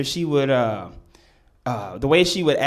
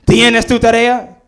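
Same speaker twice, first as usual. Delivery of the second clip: higher, louder stern teacher demand; 8 semitones higher, 8 dB louder than usual. Retold or imitated. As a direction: press him for the homework, sharply. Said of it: "she was like".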